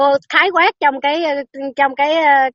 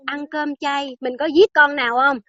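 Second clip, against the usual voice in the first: normal talking voice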